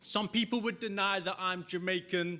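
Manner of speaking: high, projected rhythmic recital; spoken-word performance. Acted. Poet leading a crowd's call and response, high declaiming. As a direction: declaim it in punchy lines, leaving room for responses.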